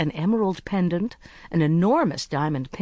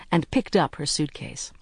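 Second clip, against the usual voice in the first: usual speaking voice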